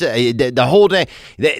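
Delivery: ordinary talk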